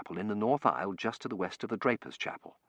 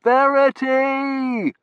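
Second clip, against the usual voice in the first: long, high, loud call; 12 dB louder, much slower than usual. A narrator's reading. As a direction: stretch the name over the din. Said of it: "I called".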